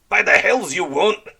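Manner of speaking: pirate captain bellowing a refusal; snapped. Acted. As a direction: slam the idea down. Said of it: "Red Ram snapped".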